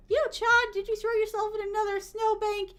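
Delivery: high, loud mock hollering; acted scolding call. Someone finding streamer in a snowbank, acted scolding call. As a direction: holler it across in playful disbelief. Acts someone calling to her.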